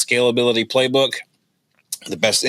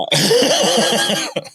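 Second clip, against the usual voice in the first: loud laugh; 14 semitones higher, 3 dB louder than usual. Real, not acted. Host laughing at the joke.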